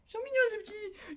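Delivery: beaucoup plus aiguë, gaga; voix de bébé. Real, not acted. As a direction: parler aux petites figures comme à des bébés. (voix gaga attendrie)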